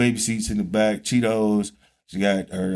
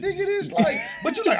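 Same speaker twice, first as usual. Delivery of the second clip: laughing disbelief; faster than usual. Real, not acted. Laughing disbelief at friend's age.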